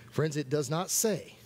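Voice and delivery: ordinary talk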